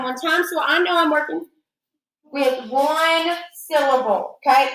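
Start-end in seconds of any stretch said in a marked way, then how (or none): 2.3-4.4 "one syllable" drawn out slowly; slow down on "one syllable" for the kids; slow teacher emphasis. Shown as a demonstration. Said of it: "I clapped once".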